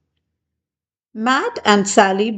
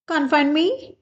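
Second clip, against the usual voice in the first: called out; 7 semitones higher, 4 dB quieter than usual. A narrator's reading. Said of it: "called Seth".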